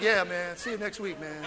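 easy after church; mellow goodbye. Retold or imitated. Churchgoer saying bye to Florida, mellow. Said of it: "talking to Florida like".

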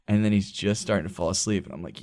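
ordinary talk

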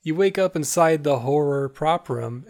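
ordinary talk, nothing marked

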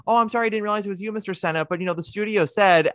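a hint of a timid guard saying sorry; polite watchman. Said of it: "He's like".

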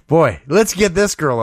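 usual speaking voice

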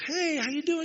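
put-on high, bright hello to an old friend; cheery greeting.

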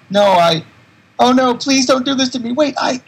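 high, loud terrified pleading; panicked plea. Retold or imitated. Larry begging the negative being, high loud panic. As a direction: beg in panic as it takes you.

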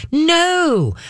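sharp, high emphatic no; firm no. Real, not acted. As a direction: shut it down firmly. Cutting off the begging.

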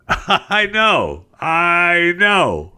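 high, drawn-out fake sympathy; laugh, then pitch it high and stretch it; exaggerated high "I know"; mock commiseration. Mock sympathy over pulled shirt.

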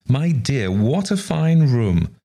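high, drawn-out society gush; acted delighted admiration; admiring exclamation. A narrator's reading. Visitor admires the room.

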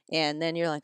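ordinary talk

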